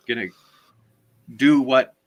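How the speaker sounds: usual speaking voice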